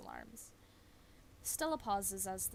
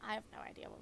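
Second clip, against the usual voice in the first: normal talking voice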